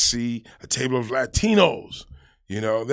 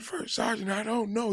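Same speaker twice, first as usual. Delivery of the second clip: meek reply; 10 semitones higher, 6 dB quieter than usual. Retold or imitated. Private answers sergeant meekly.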